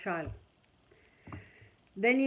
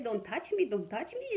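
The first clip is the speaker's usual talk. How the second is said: ordinary delivery, nothing marked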